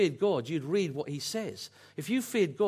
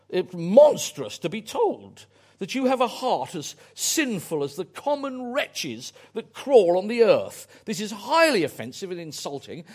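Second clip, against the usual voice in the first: Duchess of Buckingham; 4 semitones higher, 6 dB louder than usual. Retold or imitated. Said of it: "who I can imagine has a voice like this".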